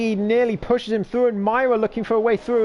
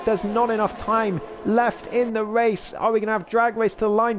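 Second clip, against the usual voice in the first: normal talking voice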